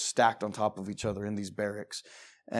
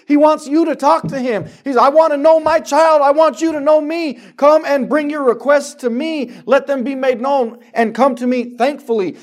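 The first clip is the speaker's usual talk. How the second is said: high, shouted sermon delivery; loud, urgent preacher's cry; preaching at full force; 14 semitones higher, 16 dB louder than usual. Real, not acted. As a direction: belt it out to the congregation.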